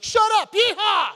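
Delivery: high, loud mock-annoyed yell; mock shout. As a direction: grudgingly bark out the cheer. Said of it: "I was like".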